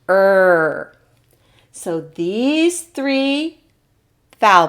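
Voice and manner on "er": a sustained "er" for others to join; drawn-out "er" sound. Shown as a demonstration. Teacher leads learners on U R, drawn-out "er". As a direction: hold "er" long and steady. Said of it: "say it with me".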